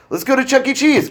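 high, loud and bright, selling the idea; eager suggestion. Retold or imitated. Dad eagerly suggesting bowling to the family, high and loud. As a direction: pitch it like a fun family outing.

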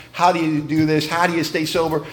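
normal talking voice

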